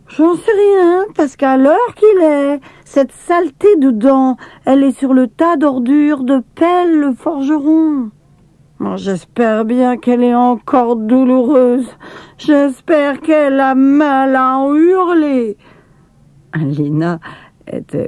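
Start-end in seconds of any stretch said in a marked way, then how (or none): 0.1-8.1 put-on triumphant little girl telling where the tooth went; cheeky girl Lina. A narrator's reading. Lina tells Maya the tooth is at the smith's, high and cheeky. Lina's voice in dialogue. 8.8-15.6 put-on high, slowing old woman's voice, grumbling; an old servant wishing pain on the tooth; spiteful old woman Maya. A narrator's reading. Old Maya scolds Lina about the tooth, high and grumbling. Maya's voice in dialogue.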